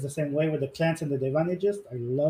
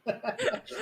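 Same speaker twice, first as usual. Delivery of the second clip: short laugh; 7 semitones higher, 7 dB quieter than usual. Real, not acted. Laughs recalling saving teammate.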